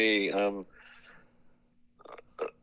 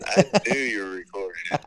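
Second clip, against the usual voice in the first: ordinary talk, nothing marked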